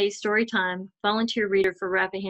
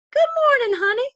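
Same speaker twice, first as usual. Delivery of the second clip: much higher, louder sing-song hello; warm greeting; 6 dB louder than usual. Acted. Lady walking her dog greets the girl, bright warm hello. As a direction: greet her like a friendly neighbour. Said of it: "greeted her warmly".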